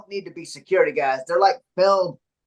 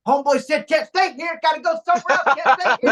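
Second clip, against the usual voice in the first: hype man; 7 semitones higher than usual. Retold or imitated. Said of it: "trying to be my hype man".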